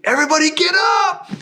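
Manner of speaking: high, loud wake-up yell; alarm shout. Retold or imitated. Comms guy yells at the sleeping team to get up, high and loud. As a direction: rouse the whole house.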